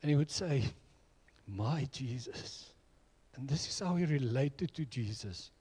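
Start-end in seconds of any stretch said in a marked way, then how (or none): 1.4-2.7 quiet, slow and tender; soft and tender. Retold or imitated. Friend answering the preacher's teasing, quiet and tender. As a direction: say it gently, like something precious. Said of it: "with a softness and tears in his eyes".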